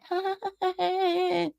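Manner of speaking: high, soft fake giggle; imitated laugh. Mimicking streamers laughing off a complaint, high mock giggle. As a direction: mimic their tittering laugh. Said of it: "then they sort of laughed about it".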